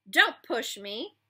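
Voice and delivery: slow, loud warning. Acted. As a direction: draw the line, slowly. Quarrel starts.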